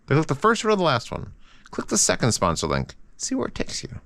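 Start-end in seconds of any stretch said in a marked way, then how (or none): 3.1-4.0 breathy secret whisper, much softer; hushed mischievous aside; whispered tease. Real, not acted. Teasing the sponsor link.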